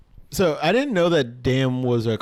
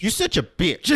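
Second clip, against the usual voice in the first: put-on a touch of corny kid, pitched up; little kid voice; 5 semitones higher than usual. Retelling the curse said to a sister, light kid voice. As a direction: lightly imitate a kid cursing. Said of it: "like, a little kid voice".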